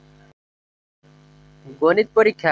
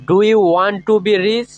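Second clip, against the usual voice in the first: each word spoken slowly and clearly for learners; teacher modelling a question slowly; slow, careful English; 2 semitones higher, 3 dB louder, much slower than usual. Shown as a demonstration. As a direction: lay out every word so students can copy it. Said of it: "এইভাবে।".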